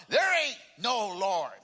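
loud, drawn-out angry retort; angry shout. Acted. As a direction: shout back as the fed-up atheist neighbor. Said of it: "he would shout".

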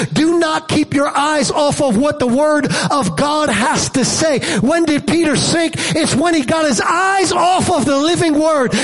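full-force preaching. Acted. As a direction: drive the warning home to the crowd. Preaching warning, voice raised.

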